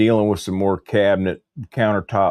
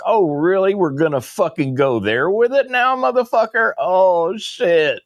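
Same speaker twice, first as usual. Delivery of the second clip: high-pitched, incredulous comeback; retold outraged challenge; 11 semitones higher than usual. Customer retelling his reaction to a trespass threat, outraged. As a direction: rise into indignant disbelief, voice pitched well up.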